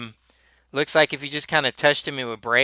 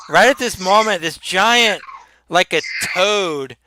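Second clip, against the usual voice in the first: normal talking voice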